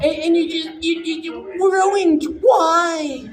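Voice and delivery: high, loud, slowed shout of betrayal; outraged shout. Retold or imitated. Comic reliving the viewer's outrage, shouting high. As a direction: yell at Bob as if he wrecked it.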